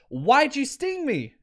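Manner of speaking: high, slow, wounded protest; a hint of a betrayed frog, drawn out; lightly voiced dying frog. Dying frog questions the scorpion.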